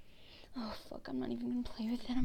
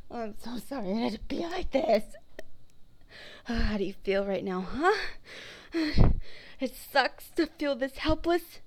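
angry accusing shout; bitter outburst; 3 semitones higher, 8 dB louder than usual. Acted. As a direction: let the grievance boil over. Bitter outburst at captive listener.

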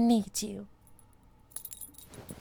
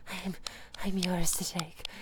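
soft unvoiced whisper, lower; whispered repeat; 4 semitones lower, 8 dB quieter than usual. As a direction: breathe the words as a whisper.

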